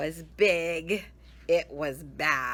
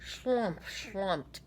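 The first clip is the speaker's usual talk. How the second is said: ordinary delivery, nothing marked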